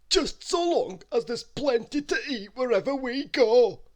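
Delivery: high, slow cartoon glutton voice; dreamy, hungry cartoon voice; greedy character voice.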